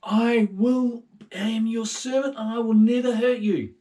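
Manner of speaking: high, loud earnest servant's vow; a young servant calling out his promise; David's plea. Acted. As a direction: swear loyalty loudly, voice up high. David pledging to Saul.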